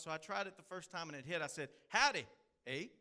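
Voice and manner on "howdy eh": loud, higher Texan howdy with a Canadian "eh". Shown as a demonstration. Preacher greets his Canadian congregation, loud Texan-Canadian howdy. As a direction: belt a Texas greeting, then tack on Canadian "eh". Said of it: "my flavor with the Canadian flavor".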